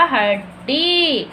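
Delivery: "हड्डी" split at the double ड, long final ई; very slow two-part "हड्डी"; sounding out. Shown as a demonstration. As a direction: slowly dictate "हड्डी", stretching the last vowel. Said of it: "यहाँ पे ई की मात्रा".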